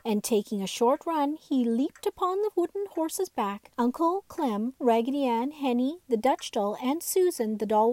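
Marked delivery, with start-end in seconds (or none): none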